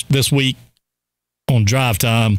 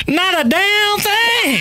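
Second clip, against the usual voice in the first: slow, higher imitated boy; high slow mock boy; much slower than usual. Son answering his mother in a put-on voice. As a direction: drag it out in a high voice. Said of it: "He said".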